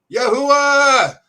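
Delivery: high, long-drawn holler; shouted call. Retold or imitated. Calling down to brothers at the airport, shouted. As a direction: call out loud and high, stretching the last syllable. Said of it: "So I shouted down".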